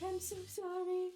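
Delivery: high, pleading re-enacted apology; acted-out regretful whine; sad apology. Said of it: "just sadness in our voice".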